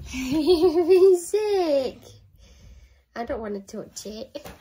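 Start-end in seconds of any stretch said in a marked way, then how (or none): 0.1-2.0 much higher, louder, very slow coo. Real, not acted. Mum asking the baby about sick, slow high coo. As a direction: drag it out in mock horror. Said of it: "repeated exaggerated".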